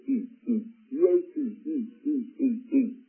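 each "eat" a separate, high-pitched beat; emphasis on eat. Shown as a demonstration. Preacher counts ringed words in his Bible, "eat" punched. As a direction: punch out "eat" repeatedly, spelling E A T. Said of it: "The emphasis on the word eat".

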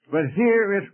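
usual speaking voice

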